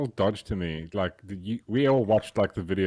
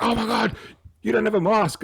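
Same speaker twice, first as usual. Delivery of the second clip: mock shocked cop; 11 semitones higher than usual. Voicing covid-era police.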